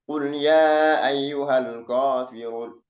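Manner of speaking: slow, drawn-out Quranic recitation. Shown as a demonstration. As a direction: recite it slowly, stretching each vowel.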